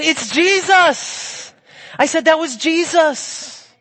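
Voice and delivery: high and loud, each word slowly drawn out; excited exclamation. Retold or imitated. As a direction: cry it out in slow, joyful triumph. Joyful exclamation at the news.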